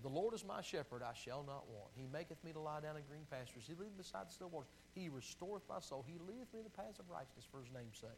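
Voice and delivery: soft, tender psalm reading; quiet reverent recitation. Real, not acted. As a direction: read it softly like a prayer. Recites Psalm twenty-three.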